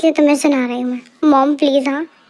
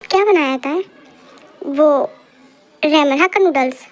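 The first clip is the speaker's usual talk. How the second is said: normal talking voice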